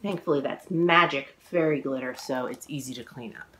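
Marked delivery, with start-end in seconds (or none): none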